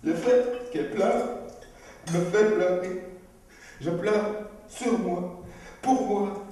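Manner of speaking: high, loud, overflowing; cathartic release. Acted. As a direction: let everything pour out. Release of tears, elated.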